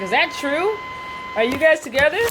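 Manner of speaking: high-pitched, incredulous questioning; imitated disbelief. Brandon asking if they're a couple, high mock disbelief. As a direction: mimic a startled guy, voice jumping up.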